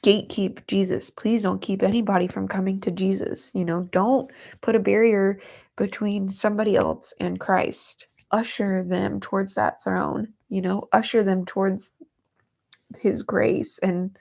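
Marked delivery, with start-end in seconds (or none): none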